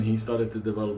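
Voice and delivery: usual speaking voice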